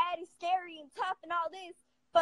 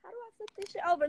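ordinary talk

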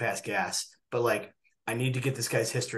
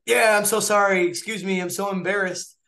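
sheepish apology; 8 semitones higher, 6 dB louder than usual. Retold or imitated. Doctor apologising to patient, mock mortified apology. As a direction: plead sorry, embarrassed and exaggerated. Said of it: "And I was like".

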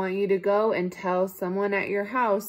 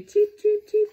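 high, loud chirpy cheeps; baby bird cheeps; 9 dB louder than usual. A narrator's reading. Baby birds cheeping from the nest, high and chirpy. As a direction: chirp like tiny hatchlings.

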